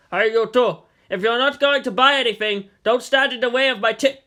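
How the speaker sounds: irritated street vendor calling out; merchant yelling. Acted. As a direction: shoo them off your stall.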